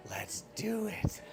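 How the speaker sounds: slow, very soft acted quote; hushed agreement. Acting out agreeing with Olivia on the rental, hushed agreement.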